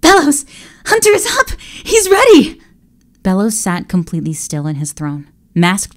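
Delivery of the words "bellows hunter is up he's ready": very high, loud and breathless; bursting with excitement; excited. Acted. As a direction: blurt out the news. Bursting in with news.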